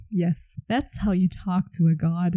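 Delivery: normal talking voice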